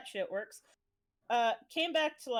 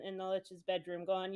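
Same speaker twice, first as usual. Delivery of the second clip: ordinary delivery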